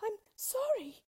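put-on high, frightened girl's voice; frightened apology. A narrator's reading. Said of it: "stammered Dorothy".

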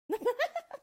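high, loud evil cackle; witch cackle. Acted. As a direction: cackle in triumph. Witch snatches the shoe.

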